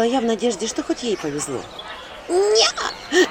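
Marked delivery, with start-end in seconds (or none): 2.3-3.3 high sobbing little no. A narrator's reading. Anchutka sobs her reply to the healer. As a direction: blurt it through a sob. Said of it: "всхлипнула она".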